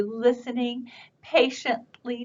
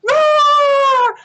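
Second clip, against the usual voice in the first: loud, high, drawn-out play roar; big roar for the children; dinosaur roar; 9 dB louder than usual. Acted. Voices roaring dinosaur in story.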